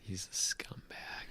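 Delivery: a hushed whispered insult; soft unvoiced whisper. Real, not acted. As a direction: whisper it like a secret. Whispered aside.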